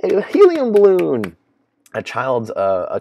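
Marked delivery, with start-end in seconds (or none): 0.0-1.4 much higher, louder, slow sarcastic cheer; sarcastic victory cheer over a party balloon; mock cheering. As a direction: hoot it like a gullible fan. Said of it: "we're over here cheering".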